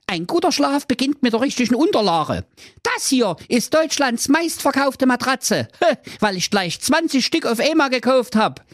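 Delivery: very high, boastful sales pitch; high chirpy pitchman; salesman prince. Acted. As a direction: sell it like a shop ad.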